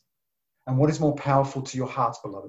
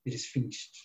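hushed, much softer, falling; soft whisper; 3 semitones lower, 11 dB quieter than usual. Retold or imitated. Jesus on the cross, whispered softly. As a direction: let it out like a last breath. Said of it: "the whisper of Jesus".

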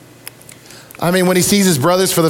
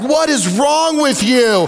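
wife, baffled; 6 semitones higher, slower than usual. Retold or imitated. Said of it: "my wife's like".

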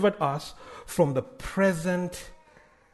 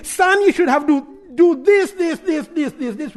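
lecturing dad; 10 semitones higher, 6 dB louder than usual. Retold or imitated. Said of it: "and I'm just saying".

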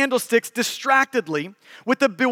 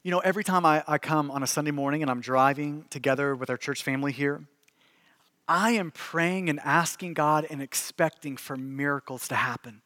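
hushed pastoral appeal; 5 semitones lower, 4 dB quieter than usual. Acted. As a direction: draw the room quietly into prayer. Soft closing and call to prayer.

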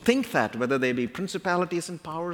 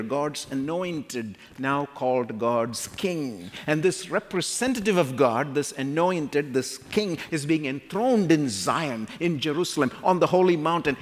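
normal talking voice

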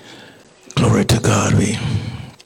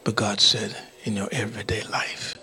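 very soft, solemn preaching; hushed exhortation; 12 dB quieter than usual. Real, not acted. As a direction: drop to a near-whisper with long pauses. Preacher hushes to drive point home.

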